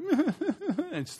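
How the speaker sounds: high, slow, whiny fake sob; mock the teenager's put-on crying; fake teen cry. Parent imitating a teenager's manipulative fake cry.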